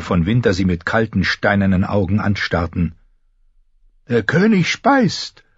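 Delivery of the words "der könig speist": slow, high-pitched jesting king; Robert's voice. A narrator's reading. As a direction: voice the king telling a crude joke, pitched way up. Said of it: "hatte Robert gesagt".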